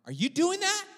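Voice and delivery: very high-pitched, incredulous question; startled disbelief. Retold or imitated. Preacher startled by brother's cracking knee, asks in high disbelief. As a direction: voice leaps up in mock alarm.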